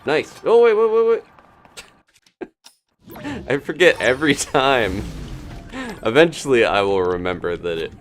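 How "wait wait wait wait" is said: ordinary talk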